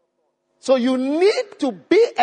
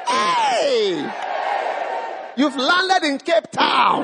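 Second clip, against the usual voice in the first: mock announcer shout; 5 semitones higher, much slower than usual. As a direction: hail the newborn like a showman. Jokey greeting to newborn.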